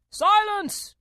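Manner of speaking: high, loud royal shout; called out. A narrator's reading. King hushes the court, called out loudly. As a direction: shout for order in a king's voice. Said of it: "called out".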